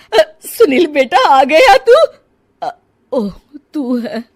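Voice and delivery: tearful. Acted. Mother mistakes son, crying.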